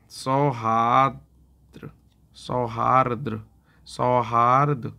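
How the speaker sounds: सौहार्द drawn out slowly, syllable by syllable; teacher spells out सौहार्द slowly with the र before द; slow careful pronunciation. Shown as a demonstration. शिक्षक छात्रों के लिए सौहार्द को धीरे-धीरे साफ़ बोलते हैं. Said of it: "करेक्ट उच्चारण होगा सौहार्द".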